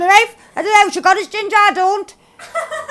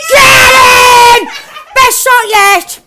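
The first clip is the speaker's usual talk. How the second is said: loud slow victory yell; triumphant shout; 7 semitones higher, 8 dB louder, much slower than usual. Real, not acted. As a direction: cheer the shot. Celebrating a kill.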